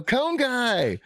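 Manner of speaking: high shouted call, retold with glee; high excited shout of recognition; called out cheer. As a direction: holler the nickname like a fan. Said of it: "They go".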